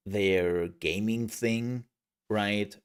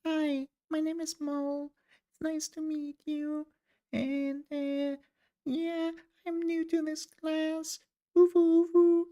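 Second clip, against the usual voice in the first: very high, cutesy falsetto; anime uwu voice. Shown as a demonstration. Introducing self to a new class, anime uwu voice. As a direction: squeak it like a shy anime character. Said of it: "like an anime ugu".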